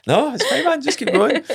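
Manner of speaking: very high, laughing reply; mock protesting reassurance. Passenger tells driver to carry on, mock protest. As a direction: wave him on in a high, comic plea.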